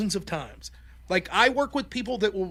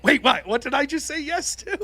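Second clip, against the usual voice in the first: higher, suddenly alarmed double take; startled confusion; 6 semitones higher, 3 dB louder than usual. Acted. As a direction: act caught out and baffled. Acting out sudden confused realisation.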